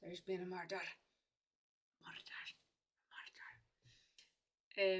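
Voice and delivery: a classic Glaswegian tagger line; lightly done Glaswegian accent, dying away. Retold or imitated. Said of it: "a classic tagger".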